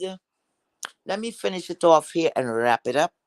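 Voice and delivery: usual speaking voice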